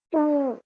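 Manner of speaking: उ as a clear demo sound; vowel u said alone. Shown as a demonstration. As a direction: call उ as a short u, lifted.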